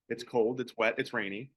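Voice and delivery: normal talking voice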